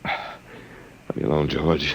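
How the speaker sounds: slow, breathless plea through pain; barely voiced, slow, as if racked by pain; strained whisper in pain. Walter begs George to stop the vibration, pained whisper. Prisoner under painful vibration torture.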